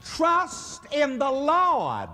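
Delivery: slow, high, emphatic preaching; preaching the text. Acted. Preacher leading the congregation in scripture, drawn out and emphatic. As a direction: lift each word up to the congregation.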